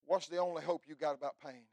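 ordinary talk

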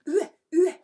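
high, loud mock barking; dog's bark. Showing the song a dog makes, mock bark. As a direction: bark the French word like a dog. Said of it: "That's the song a dog makes".

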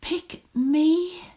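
high, pleading stage whisper; inward whisper. A narrator's reading. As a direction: whisper it like a secret, urgent wish. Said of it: "whispered inwardly".

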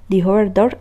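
ordinary delivery

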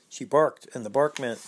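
plain reading voice

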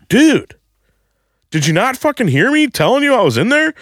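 high-pitched outraged disbelief; indignant confrontation. Retold or imitated. Man confronting stranger at bathroom door, high indignant protest. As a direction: demand an answer, voice way up high.

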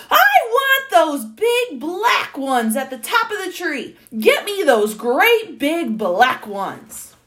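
redone as a shout, high and loud. A narrator's reading. Man demanding cherries from the tree top, shouting. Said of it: "Oh, it said he was shouting".

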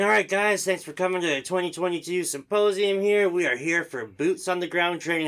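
high, loud course-opening welcome; acted instructor's announcement voice; instructor addressing the room. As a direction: project like an upbeat instructor greeting a class.

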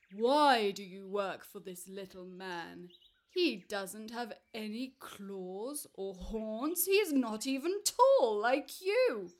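loud, booming giant questioning the buffalo; big giant voice, baffled and insistent; puzzled giant. Acted.

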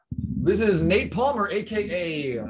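slow, drawn-out DJ intro; radio voice. Pretend. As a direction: announce yourself like a radio host. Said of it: "I'm gonna get my radio voice".

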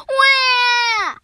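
loud, high, drawn-out pretend bawl; mock crying wail. Whacked shark starts to cry, loud mock wail. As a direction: wail like a cartoon crybaby. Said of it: "The shark started to cry".

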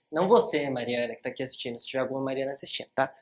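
rushed, loud joking aside; quick aside. Real, not acted. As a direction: toss it off fast to viewers. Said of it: "aside to viewers". (aparte rápido e brincalhão)

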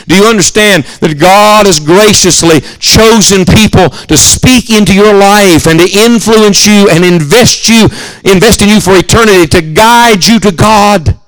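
rising, slowing preacher's appeal; earnest pulpit build, drawn out; preaching. Acted. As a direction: lean into the plea like a preacher.

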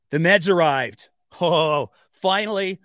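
usual speaking voice

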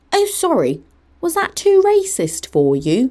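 high, slowed-down snide fake sorry; mock apology. Imitating a friend's snide apology after being called out, mockingly. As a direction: put on a high, patronising voice.

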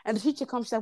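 ordinary talk

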